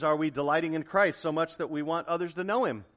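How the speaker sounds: ordinary talk, nothing marked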